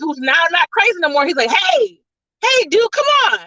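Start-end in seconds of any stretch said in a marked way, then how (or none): 1.5-3.4 pitched-up pleading hey dude; frantic high-voiced appeal to the others; high urgent plea. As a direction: call out to stop them, much higher. Otto pleading with fleeing villains.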